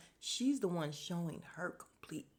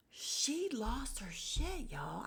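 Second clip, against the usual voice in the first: whisper; 5 semitones higher, 5 dB quieter, much slower than usual. Real, not acted. Gossiping aside to viewers.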